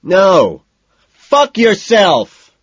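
very loud, high, slow screaming fury; shrieking rage over the stall divider; screaming back. A narrator's reading. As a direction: scream it at the top of your lungs. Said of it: "Price screams back".

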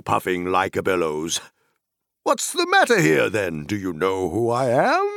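cheerful booming country voice; jolly Tom Bombadil. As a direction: boom like a merry forest master.

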